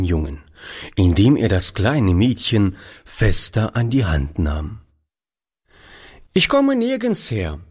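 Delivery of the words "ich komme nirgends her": put-on unruffled boy, pitched high; casual high-voiced reply; nonchalant. A narrator's reading. Street boy answers the old man's question, nonchalant. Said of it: "antwortete dieser gelassen".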